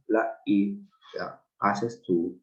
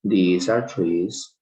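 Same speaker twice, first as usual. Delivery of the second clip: very slow, careful These are trees; very slow model sentence; 3 semitones lower, 3 dB louder, much slower than usual. Shown as a demonstration. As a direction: stretch every word of These are trees for the class. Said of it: "Aquellos o esos son lápices".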